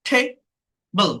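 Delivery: "table" broken in two, a pause between; split into syllables. Shown as a demonstration. Teacher counting syllables for learners, "table" split apart. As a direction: say "table" as two separate beats. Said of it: "two syllable".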